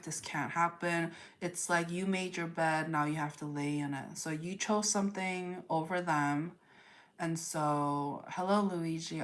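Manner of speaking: normal talking voice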